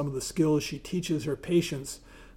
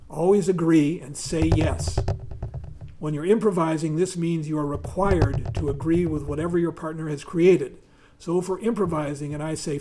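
louder, lively reading from a book; reading aloud; 5 dB louder than usual. Acted. Reading Tina Fey's improv rules to the audience, lively read-aloud voice. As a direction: read it out to the room with energy.

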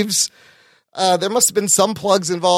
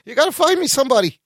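mock Han Solo whine; 6 semitones higher than usual. Mock Han Solo complaining to Chewie.

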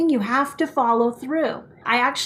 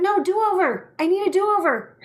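higher-pitched reenacted insistence; lightly hammed-up, high-pitched request; acted do-over plea; 7 semitones higher than usual. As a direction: replay yourself calling for a retry, pitched up. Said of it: "And I was like".

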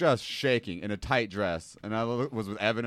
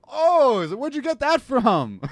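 high, loud drawn-out "oh", retold; mock astonishment; 13 semitones higher, 8 dB louder, slower than usual. Kitchen guy reacting to the smell of fingers, mock astonished. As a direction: imitate a startled reaction, pitched way up.

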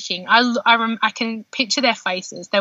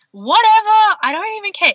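high, louder breezy shrug; carefree dismissal; 11 semitones higher, 4 dB louder than usual. Retold or imitated. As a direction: brush it all aside, blissfully unbothered. Retold carefree reaction in birth.

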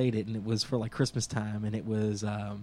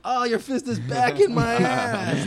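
much higher, louder, slow mock shriek; mock screaming woman; 10 semitones higher, 6 dB louder, slower than usual. Woman in labor yelling at the dictator, high mock shriek. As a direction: wail it in fake outrage. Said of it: "She's like".

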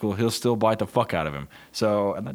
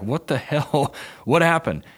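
incredulous; 8 semitones higher, 4 dB louder than usual. Retold or imitated. Reenacts reaction to dog bite.

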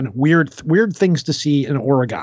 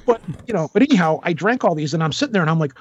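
normal talking voice

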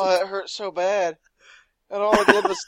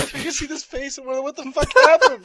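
laughing; 10 semitones higher, 5 dB louder than usual. Real, not acted. Laughing at the show image.

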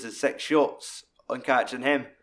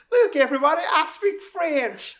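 proud announcement; 7 dB louder than usual. Retold or imitated. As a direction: announce it grandly to the room, way up high. Minnie showing off her French.

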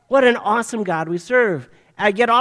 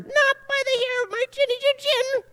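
much higher squeaky piggy voice; squeaky little pig. Acted. Preacher playing the pig refusing the wolf, high squeaky voice. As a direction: squeak it out like a tiny frightened pig. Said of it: "And the little pig says".

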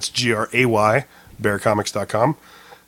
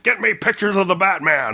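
barking newspaper editor, higher pitched; Jonah Jameson bark; 8 semitones higher than usual. Retold or imitated. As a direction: bark it like a gruff boss. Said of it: "Jonah Jameson?".

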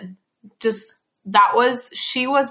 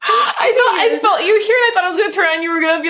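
startled laughing; 8 semitones higher, 6 dB louder than usual. Real, not acted. Startled, thought sister would scare.

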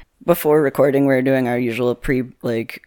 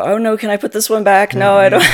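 high-pitched mock dismay, getting louder; imitated cringing; 8 semitones higher, 6 dB louder than usual. Mimicking parents cringing as they draw cards, mock dismay. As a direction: squeal it like a squeamish parent, breaking into laughter. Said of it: "my parents would cringe and go".

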